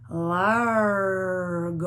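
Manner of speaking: drawn-out, sustained delivery of a slow tempo word. Shown as a demonstration. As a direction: stretch the word right out, long and slow. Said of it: "very slow".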